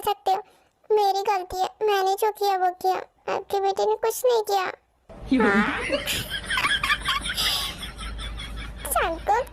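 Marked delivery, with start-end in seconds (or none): 5.7-8.8 high shrieking laugh. Acted. Mother delighted by son's boyfriend, shrieking laugh. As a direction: squeal with laughter, much higher.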